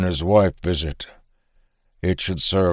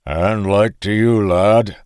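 put-on a farmer's Frisian accent, a little higher; 3 semitones higher, 5 dB louder, slower than usual. Farmer greets the young stranger, Frisian accent. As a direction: greet the lad in a Frisian lilt. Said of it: "in a voice as deep as his Frisian accent".

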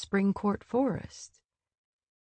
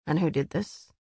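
slightly lowered, level question; flat voice; 3 semitones lower than usual. A narrator's reading. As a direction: ask it evenly, giving little away. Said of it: "Eris's voice remained flat".

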